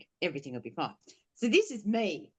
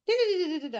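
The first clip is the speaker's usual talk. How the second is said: mock nonsense chant; 13 semitones higher, much faster than usual. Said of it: "And he's like".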